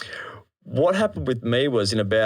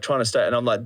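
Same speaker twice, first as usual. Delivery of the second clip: ordinary talk, nothing marked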